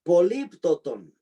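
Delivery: políptoton said slowly, each syllable spelled out for the class; a teacher drilling the word políptoton for students to write; slow dictation. Shown as a demonstration. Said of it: "teacher drills a term".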